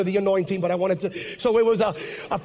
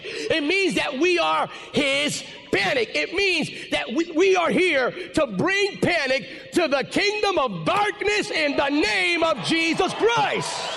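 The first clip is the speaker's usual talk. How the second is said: preaching climax; 6 semitones higher, slower than usual. Real, not acted. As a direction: proclaim it slowly at full force.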